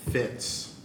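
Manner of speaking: usual speaking voice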